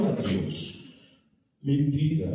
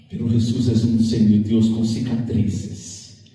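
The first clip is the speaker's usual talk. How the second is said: fervent sermon voice building louder; preaching; 6 semitones higher, 7 dB louder than usual. Acted. Pastor preaching to the church, fervent and building.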